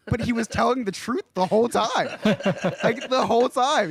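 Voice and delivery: laughing disbelief. Real, not acted. Punchline of scam story.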